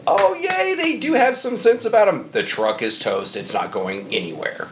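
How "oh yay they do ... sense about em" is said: high sarcastic yay; mock cheer. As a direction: squeal it in fake relief.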